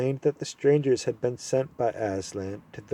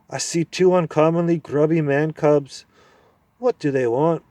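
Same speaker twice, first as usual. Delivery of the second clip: high, loud cranky dwarf; deaf old dwarf; 5 semitones higher, 5 dB louder than usual. Acted. Deaf dwarf mishearing the owl, loud and cranky. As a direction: mishear it and bark back.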